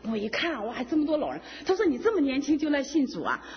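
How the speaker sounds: ordinary talk, nothing marked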